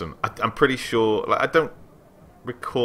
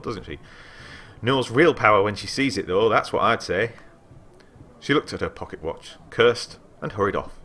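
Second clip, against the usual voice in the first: ordinary talk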